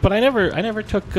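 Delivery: a touch of frustrated protest; insistent denial. Retold or imitated. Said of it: "I'm like".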